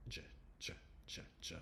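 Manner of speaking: soft, short "J" sounds like a soft G, evenly repeated; "J" as the opening sound of jar, not the letter name; isolated J sound. Shown as a demonstration. Teacher breaking the J out of jar, isolated sound. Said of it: "it only has one sound".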